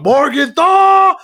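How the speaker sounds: drawn-out mock battle cry, high and loud; screaming. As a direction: bellow the name like an enraged soldier, hammed up. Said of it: "he's sitting there screaming".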